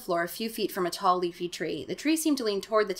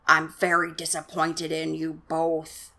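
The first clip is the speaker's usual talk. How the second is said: slow, lower cool father voice; low, flat voice; 3 semitones lower, slower than usual. Acted. Doctor Brewer scolding his children, low slow and flat. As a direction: say it cold and level, not angry. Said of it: "in a low, flat voice".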